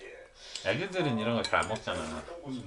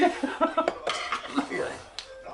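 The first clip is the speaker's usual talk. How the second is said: airy unvoiced laugh; breathy laugh. Real, not acted. Laughing at someone eating spicy food.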